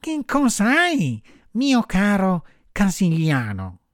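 high, drawn-out little marmot voice; high-voiced, caring animal neighbour; solicitous marmot. A narrator's reading. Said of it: "gli domandò premurosamente".

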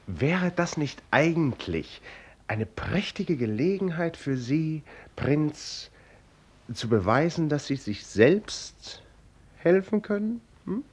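hoch und langsam, spöttisch aufziehend; neckend. Acted. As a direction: sanft stichelnd wie zu einem Kind. Erzähler neckt den Prinzen. (leise neckend, mit hoher Stimme)

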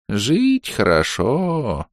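медленно, тихо и блаженно; протяни с довольством. A narrator's reading. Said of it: "блаженно сощурился дедушка". (блаженный старик, очень медленно)

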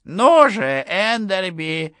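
put-on high, whiny old general, drawn out; petulant general. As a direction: demand it like a cranky old man. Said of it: "капризничал тем временем генерал-майор". (капризный генерал)

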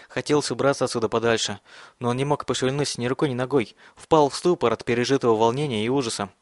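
usual speaking voice

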